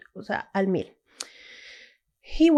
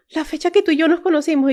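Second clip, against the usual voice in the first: quick, higher and louder, imitating husband's eager guess; hammed-up eager realisation; excited guess; 6 semitones higher, 5 dB louder, faster than usual. Her husband guessing the date on the keychain, excitedly.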